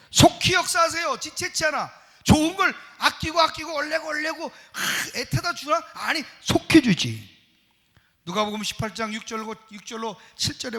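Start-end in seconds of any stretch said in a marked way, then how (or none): 0.1-7.3 higher, louder preaching that rises; fervent preaching. Acted. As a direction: press the point with fire. Rising preaching fervour. (높고 힘찬 설교 목소리)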